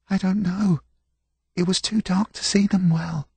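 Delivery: put-on high, anxious young servant; frightened boy voice. A narrator's reading. Frightened serving boy answers.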